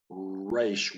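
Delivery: "reish" with a rounded-lip English R. Shown as a demonstration. Teacher showing learners what to avoid, English R. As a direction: mispronounce "reish" with an English speaker's R. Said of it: "That's how English speakers would say it".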